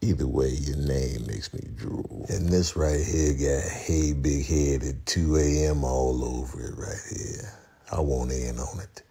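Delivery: low, soft, slow drawl; intrigued savouring. Pretend. Vlogger marvelling at lemon blueberry swirl bread, intrigued. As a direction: savour each word slowly, hushed and low.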